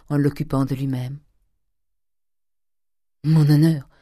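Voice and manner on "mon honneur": sudden loud outburst; cry out. A narrator's reading. Grieving young man crying out to his cousin. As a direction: fling the word out in shock. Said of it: "cria le jeune homme".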